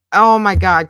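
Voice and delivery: loud, startled "oh my God"; shocked exclamation. Real, not acted. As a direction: blurt it out in disbelief. Reacting to a shocking clip.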